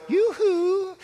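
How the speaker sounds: mock high neighbor's call; high-pitched yoo-hoo. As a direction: call Yoo-hoo like the lady next door walking in. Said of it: "rather high-pitched voice".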